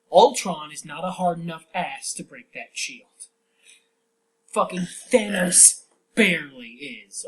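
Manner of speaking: loud, heated fanboy rant; angry rant. Real, not acted. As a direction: vent at full volume. Ranting about the broken shield.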